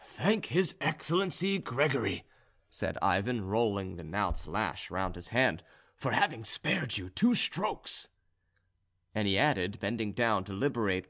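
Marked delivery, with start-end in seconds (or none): 0.0-2.2 higher voice for the serf Ivan; higher-voiced serf giving instructions; Ivan, serf character. A narrator's reading. 6.0-8.1 slow, higher serf voice; higher-voiced serf, measured and slow; Ivan, serf character. A narrator's reading.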